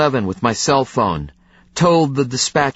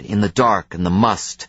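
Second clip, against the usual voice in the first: ordinary delivery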